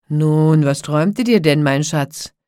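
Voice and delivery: loud, booming giant voice; domineering giant. A narrator's reading. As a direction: ask it loudly as the giant husband. Giant questions the princess. (laut dröhnender Riese)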